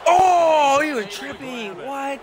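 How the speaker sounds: high cry starting loud; loud high start, then baffled; incredulous outburst. Real, not acted. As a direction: yelp in real disbelief. Outburst at play.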